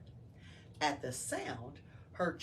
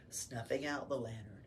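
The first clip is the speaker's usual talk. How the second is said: ordinary delivery, nothing marked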